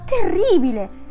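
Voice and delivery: escalating indignant cry, top of the voice; indignant outburst. A narrator's reading. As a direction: let the outrage burst out on the repeat. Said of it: "aggiunse indignato".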